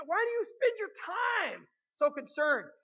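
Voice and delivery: high, loud, drawn-out plea; impassioned challenge. Retold or imitated. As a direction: cry it out slowly to a whole room. Preacher voicing Jesus's challenge.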